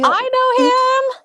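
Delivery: high, slow thrilled cry of recognition; excited. Real, not acted. Host cutting in, thrilled to know Eddie. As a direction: burst out that you know him.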